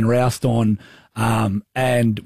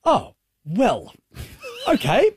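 flustered okay; 10 semitones higher, slower than usual. Retold or imitated. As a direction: caught off guard and brushing it off. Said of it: "just looks at me and goes".

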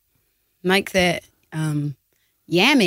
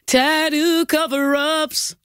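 dramatic segment title, slow and high; slow announcer voice; 6 semitones higher, much slower than usual. Pretend. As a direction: announce it like a grand new segment. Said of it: "is this the new segment".

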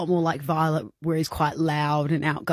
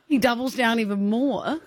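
normal talking voice

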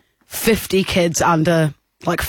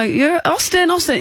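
put-on higher-pitched call to a child; retold calling out; 9 semitones higher than usual. Aunt calling a child absorbed in circling, retold call. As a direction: call the boy's name, higher.

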